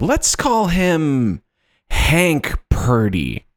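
very slow, drawn-out reveal; slow dramatic. Acted. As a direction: stretch the name out slowly. Reading script slowly.

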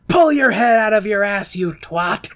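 loud, high mock outburst; mock angry heckle. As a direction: hurl it as a hammed-up insult. Heckles resisters in retold scene.

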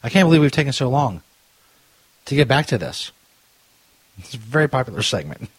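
ordinary delivery

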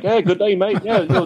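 high, hammed-up Australian accent; weird Australian accent. Tourist bluffing police to dodge questions, fake Australian accent. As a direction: put on a goofy, high-pitched Australian voice. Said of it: "weird Australian accent".

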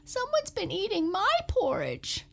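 high, squeaky little cub voice; Baby Bear squeaking to his parents about his porridge. Acted. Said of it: "squeaked Baby Bear".

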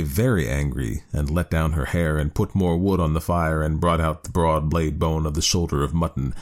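usual speaking voice

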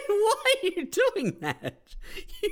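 can't believe what he hears; incredulous. Real, not acted. Friend reacts, incredulous.

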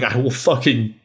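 ordinary talk

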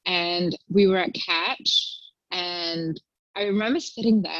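normal talking voice

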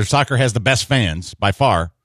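normal talking voice

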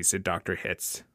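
ordinary delivery, nothing marked

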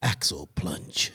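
low breathy whisper, slowed right down; giggly prank whisper. Pretend. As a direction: breathe it into the mic. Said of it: "whisper into the mic so people".

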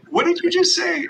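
high-pitched mock disbelief; stunned teammates. Teammates at the pregame meal, high mock disbelief. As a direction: squeak it out like you can't believe it. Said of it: "and they said".

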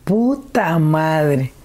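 slow, drawn-out shocked swear; host reacting to the father's cruel words, stunned; stunned curse. Real, not acted. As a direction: let the shock stretch the curse out.

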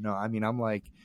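normal talking voice

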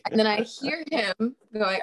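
ordinary talk, nothing marked

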